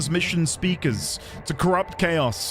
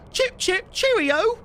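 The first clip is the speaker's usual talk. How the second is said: put-on squeaky, much higher posh British accent parody; mock British accent; slower than usual. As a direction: toss off a cartoon British accent, pitched way up. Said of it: "Sounds the same".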